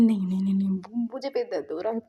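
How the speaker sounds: mock panic, stammering and uneven; retold distress in stuttered bursts; half-hearted cry of pain and denial; panicked screaming. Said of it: "चिल्ला रहा था".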